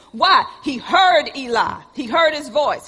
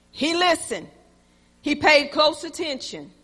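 ordinary talk

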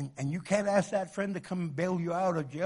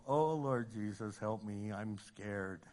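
mock frightened, fading softer; a comic little whimper of fear; mock scared; 7 semitones lower, 6 dB quieter, slower than usual. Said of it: "when you look at you go".

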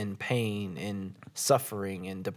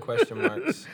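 laughing. Real, not acted. Laughing echo of phrase.

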